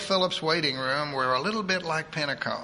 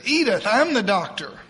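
louder, higher snap at her; abrupt outburst; 6 semitones higher, 6 dB louder than usual. Acted. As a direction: blurt it out too fast. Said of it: "Came out so fast he stunned himself".

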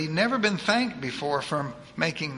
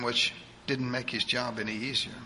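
usual speaking voice

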